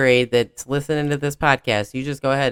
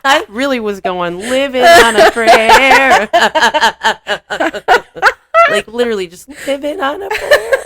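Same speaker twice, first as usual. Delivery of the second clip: high, loud laughter through words; laughing; 11 semitones higher, 8 dB louder than usual. Real, not acted. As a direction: crack up mid-sentence. Both laughing hard.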